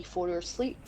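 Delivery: ordinary talk, nothing marked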